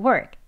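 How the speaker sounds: "work" ending with a very weak k sound; soft final k. Shown as a demonstration. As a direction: let the k of "work" fade almost away. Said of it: "크 하는 마지막 소리 그냥 아주 약하게요".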